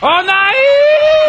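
very high, loud drawn-out wail; long high-pitched scream of "no"; dismayed shout. Real, not acted. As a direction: cry out as the game goes wrong. Player cries out at failure.

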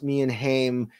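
ordinary delivery

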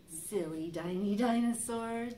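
ordinary delivery

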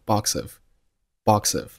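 "box of" run together as "bok-suv"; "box of" said as one word; linked reading. Shown as a demonstration. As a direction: slide the s sound of "box" into "of". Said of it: "同样辅元连读".

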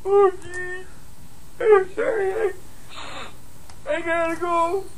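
slurring, weepy, overly sentimental call; drunk late-night voicemail. Acted. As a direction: pour your heart out, tipsy and teary.

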